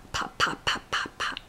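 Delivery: soft popping lip sounds; fish pops. Shown as a demonstration. Mom showing baby a fish sound, soft popping lips. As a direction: pop your lips like a fish, softly. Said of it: "what sound does a fish make?".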